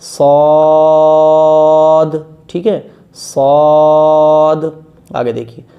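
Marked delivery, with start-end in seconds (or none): 0.0-4.6 با and تا held long, one breath each; slow Quran teacher drawing out each letter; drawn-out letter names. Shown as a demonstration. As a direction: stretch با then تا out slowly. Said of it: "پوری ایک سانس میں پڑھنا ہے".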